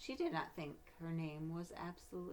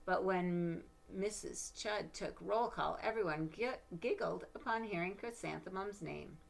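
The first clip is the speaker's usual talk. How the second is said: storybook narration, gentle and lively; reading to children; 3 dB louder, slower than usual. Acted. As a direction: read it like a bedtime picture book. Reads picture book aloud.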